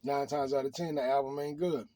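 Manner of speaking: ordinary delivery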